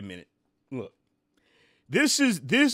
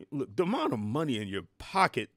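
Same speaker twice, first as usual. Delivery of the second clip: usual speaking voice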